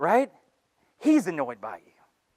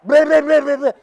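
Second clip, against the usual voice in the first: higher, louder mocking blah-blah; mock stammer; 8 semitones higher, 8 dB louder than usual. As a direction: parrot the bumbling speaker with scorn. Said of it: "That's what you sound like".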